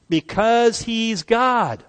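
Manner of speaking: Because he's God, slow and high with force; emphatic declaration. Real, not acted. Preacher answering the doubter, slow and emphatic. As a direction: drive it home slowly, every word weighted.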